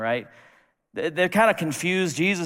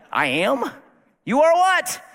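incredulous; 10 semitones higher, 4 dB louder, slower than usual. Pretend. Moses voiced incredulous.

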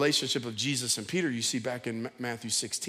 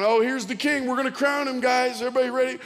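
mocking soldier; 8 semitones higher, 4 dB louder than usual. Soldiers mocking crowning Jesus.

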